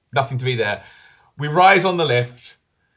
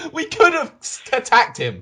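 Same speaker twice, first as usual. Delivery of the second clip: high-pitched, amused protest; laughing disbelief; 10 semitones higher than usual. Real, not acted. Player laughing at how they fled instead of attacking, amused. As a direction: say it through real laughter, incredulous. Laughing at their own cowardly escape.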